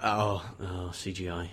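low, soft, disappointed murmur; deflated. Retold or imitated. Viewer spotting the computer effects, deflated and quiet. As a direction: let it sink quietly with disappointment. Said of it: "but instead went".

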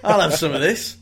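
high, giggly, greedy delight; delighted mock glee. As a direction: chirp it like you found free cake. Voicing man finding the cake.

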